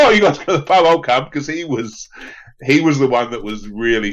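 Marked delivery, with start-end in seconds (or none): 0.0-1.3 eager mate. Retold or imitated. Mate Munster quoted keen for pub.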